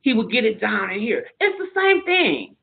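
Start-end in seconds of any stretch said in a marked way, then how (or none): 1.4-2.5 It's the same thing, lifted high on same; emphatic. Real, not acted. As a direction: push same up high, insisting. First take, emphatic high.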